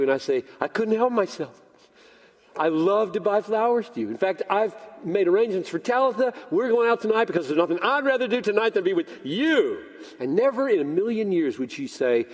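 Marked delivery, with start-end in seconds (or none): 0.6-9.8 higher, eager outpouring; joyful husband. Acted. Husband giving wife flowers, eager and joyful. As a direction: gush with joy, pace picking up. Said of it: "a totally my joy statement".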